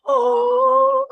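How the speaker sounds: high, loud surprised "Oh."; a sudden high burst of realization. Retold or imitated. Remembering spotting the host at school, surprised. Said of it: "And I was like".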